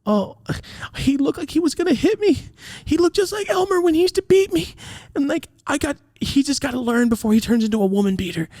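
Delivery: high, wailing mock sob of mom; fake sobbing. Mom on the phone to grandma, high fake sobbing. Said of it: "My mom started fake sobbing".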